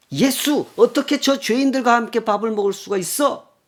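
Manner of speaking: high, loud scandalized protest; indignant complaint. Retold or imitated. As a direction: rail at Jesus like an offended Pharisee. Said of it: "왜 이렇게 불평을 하고".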